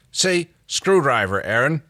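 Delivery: a touch of coaxing, drawn out; pleading. A narrator's reading. John begs Aaron to say the word, pleading. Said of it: "John pleaded".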